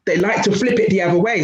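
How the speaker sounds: usual speaking voice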